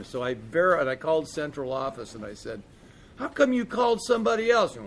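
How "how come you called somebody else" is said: indignant demand. Retold or imitated. Confronting the central office.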